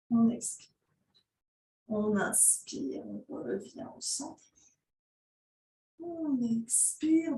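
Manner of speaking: soft, slow, drawn-out yoga instructions; yoga breathing cues. Real, not acted. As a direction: stretch each cue to the length of a breath, softly. Leads slow breath-paced stretches.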